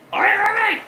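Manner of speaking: very high panicked caving Heyman. Retold or imitated. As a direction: give it up in a high frantic squeal.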